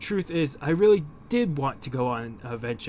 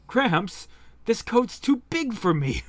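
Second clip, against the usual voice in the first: put-on childish whine; 9 semitones higher, slower than usual. Young Percival whines.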